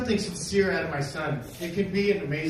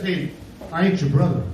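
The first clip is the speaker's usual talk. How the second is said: lower, louder menacing voice; tough guy; 3 semitones lower, 4 dB louder than usual. Retold or imitated. The giant man squares up to him, low and menacing. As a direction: loom over him with the line. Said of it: "he says".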